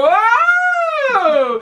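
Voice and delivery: high, loud cry of alarm; startled whoa. Acted. Jack yelling as the snake rears back, high loud whoa. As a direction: yelp it out, hanging on for dear life. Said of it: "and Jack was going".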